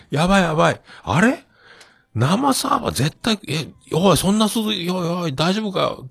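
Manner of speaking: high-pitched panic; frantic, pitch way up; panicked. Retold or imitated. Panicking self in dream.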